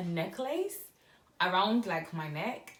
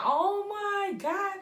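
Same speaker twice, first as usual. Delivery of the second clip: very high, slow, louder swoon; giddy squeal; 13 semitones higher, 5 dB louder, much slower than usual. Retold or imitated. Retelling her giddy swoon over the necklace, high and drawn out. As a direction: stretch it out, starstruck.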